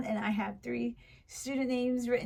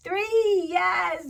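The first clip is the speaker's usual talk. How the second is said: high, loud, drawn-out confirmation; delighted teacher praise; 7 semitones higher, 6 dB louder than usual. Pretend. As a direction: cheer a child's right answer. Praising a right answer.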